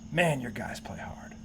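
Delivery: low, hushed, slow compliment; retold opposing coach. Opposing coach to coach at midfield, quiet sincere praise. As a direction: say it softly, coach to coach. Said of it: "the opposing coach says to me".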